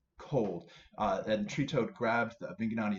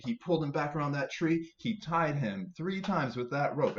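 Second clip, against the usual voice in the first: plain reading voice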